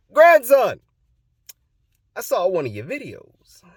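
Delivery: loud high holler easing into announcement; grandpa impression. Grandpa on the phone to his grandson, imitated booming voice. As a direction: boom the greeting, then declare it high. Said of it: "That's exactly what he sounds like".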